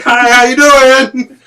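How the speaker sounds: hollered, high, long-drawn Kentucky greeting; Kentucky accent. Retold or imitated. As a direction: holler a hammed-up Southern hello, stretching every word. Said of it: "I lived in Kentucky myself".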